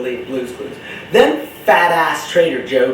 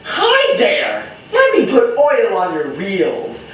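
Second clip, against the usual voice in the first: very high, sugary greeting, easing down; squeaky hello, then slow and coaxing; cartoon woman; 7 semitones higher, slower than usual. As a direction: imitate a kids' show lady. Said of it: "the woman would be like".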